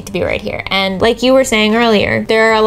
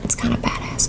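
whispered aside; 8 dB quieter than usual. Real, not acted.

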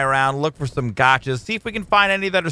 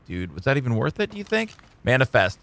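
ordinary talk

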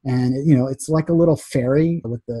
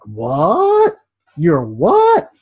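louder, rising incredulous what; mock disbelief; 2 semitones higher, 4 dB louder, much slower than usual. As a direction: play the stunned crowd. Acted crowd disbelief.

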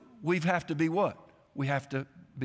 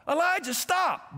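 much higher, slow, urgent warning; alarmed parental call; 12 semitones higher, slower than usual. Retold or imitated. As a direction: cry out to stop your running child. Father calls again as danger nears.

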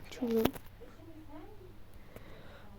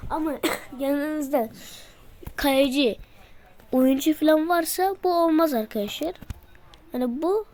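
normal talking voice

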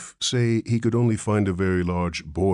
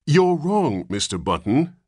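high, loud and stern; severe; 10 semitones higher, 5 dB louder than usual. A narrator's reading. Nurse scolding the father, severe. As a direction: correct him firmly, no nonsense. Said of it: "said the nurse severely".

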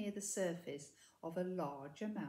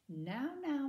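chiding; 6 semitones higher than usual. A narrator's reading. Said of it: "chided Miss Cackle".

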